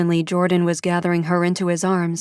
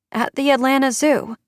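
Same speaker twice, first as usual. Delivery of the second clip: put-on high child's voice; a small girl answering; higher child's voice; little girl; 7 semitones higher than usual.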